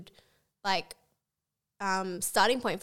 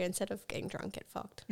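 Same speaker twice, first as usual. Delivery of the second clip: whispered slogan; 10 dB quieter than usual. Pretend.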